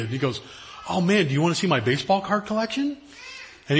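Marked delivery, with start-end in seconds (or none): 0.9-3.0 lightly voiced kid, higher and keen; eager little boy. Boy asks visiting speaker eagerly about baseball cards. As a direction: ask it like an excited eight-year-old.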